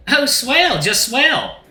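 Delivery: high, loud Southern-style hostess; folksy drag-queen host. Acted. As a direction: gush like a folksy bar hostess. Willem chats as hostess.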